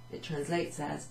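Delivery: ordinary delivery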